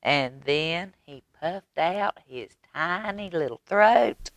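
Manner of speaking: plain reading voice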